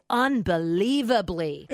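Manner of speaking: unbelievably drawn out slowly; stress on unbelievably. Retold or imitated. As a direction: stretch every syllable of unbelievably. Mocking the adverb from a quote.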